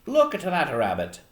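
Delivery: put-on old man slipping into Irish; attempted Maine accent drifting Irish; accidental Irish accent. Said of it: "I'm turning into an Irish accent".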